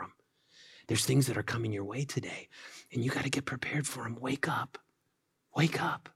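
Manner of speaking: soft, whispered wake-up call; gentle whisper. Retold or imitated. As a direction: gently coax a sleeping child awake. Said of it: "really gently and just kind of gently shake and say".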